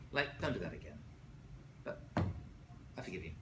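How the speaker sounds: low, soft, quiet and steady; calm firm correction. Shown as a demonstration. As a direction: set the boundary gently, then forgive. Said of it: "You hear the difference?".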